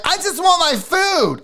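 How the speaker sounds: high, drawn-out mock whine; uppity customer. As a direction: imitate a petulant customer, stretching the words. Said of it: "She's being kinda uppity".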